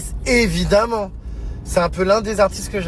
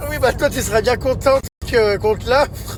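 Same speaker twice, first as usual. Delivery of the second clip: laughing; 6 semitones higher than usual. Real, not acted. Still laughing after the outburst.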